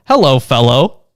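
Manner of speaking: usual speaking voice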